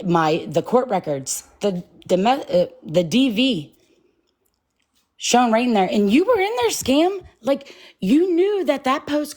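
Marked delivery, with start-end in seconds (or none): 5.9-9.4 accusing, voice shooting up; indignant outburst. Real, not acted. Calling out the streamer on live, voice jumping high in indignation. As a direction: pitch jumps high and sharp on were.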